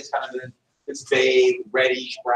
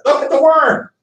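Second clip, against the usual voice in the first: excited shout; 10 semitones higher, 6 dB louder than usual. Retold or imitated. Showing worm to friends.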